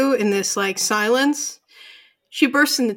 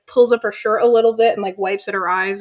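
ordinary talk

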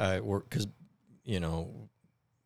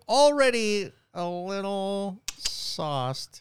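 mock tipsy; 12 semitones higher, 4 dB louder, much slower than usual. Tipsy mock-slow drawl.

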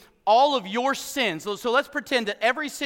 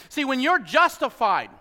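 ordinary talk

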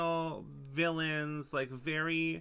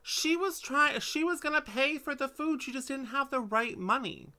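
indignant; 8 semitones higher than usual. Real, not acted. Defending the character heatedly.

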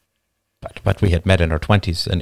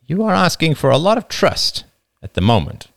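with a smile; 8 semitones higher than usual. Acted. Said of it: "though not without a smile".

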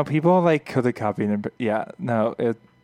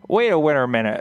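higher, louder band catchphrase with a laugh; 5 semitones higher, 5 dB louder than usual. Retold or imitated. Bandmate quoting the band's running joke, playful call. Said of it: "we would say".

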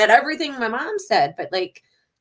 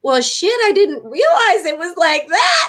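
sheepish self-quote; 6 semitones higher than usual. Retold or imitated. Daughter answers mom's rebuke, higher sheepish mock voice. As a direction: play yourself caught out. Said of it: "I'm like".

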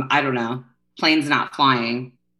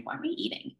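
whispered; 13 semitones higher, 13 dB quieter, faster than usual. Retold or imitated. Self-mocking disgust.

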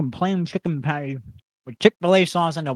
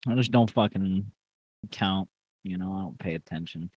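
depressed; 4 semitones lower, 6 dB quieter than usual. Real, not acted. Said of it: "you're sounding very depressing right now".

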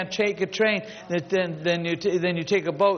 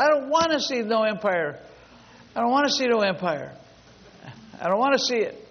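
higher-pitched, lightly voiced little girl's refusal; imitated child's protest; 7 semitones higher than usual. Retelling little girl refusing the outing.

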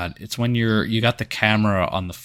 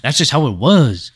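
mock Mexican accent. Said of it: "growly whispering".

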